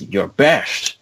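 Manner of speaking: drawn-out Scottish brogue with slurred s; scornful Scottish movie-hero impression; Sean Connery. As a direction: sneer it slowly as a Scottish action veteran. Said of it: "Sean Connery turns back to him and says".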